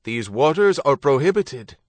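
loud official bellow through a hailer. A narrator's reading. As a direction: bellow the order across the water. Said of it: "the rating bellowed".

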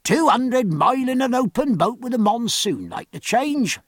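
high-pitched shout from the crowd; protesting heckler's call. A narrator's reading. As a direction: sailor calling out an objection, pitched well up. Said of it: "but one man called out".

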